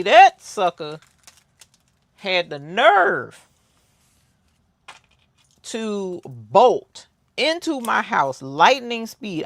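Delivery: loud, slow, outraged build; indignant storytelling. Real, not acted. Telling viewers how the cat bolted inside, loud and outraged. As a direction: lean in hard on how the cat dared.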